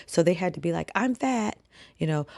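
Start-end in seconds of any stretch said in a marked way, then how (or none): 0.9-1.6 retold yell. Writer made to yell on boss's orders, high mock yell. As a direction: imitate a forced yell, pitched up. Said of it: "Yell out".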